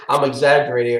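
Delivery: quick, laughing confession; laughing admission. Real, not acted. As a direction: own up through a laugh. Laughs admitting his own exaggeration.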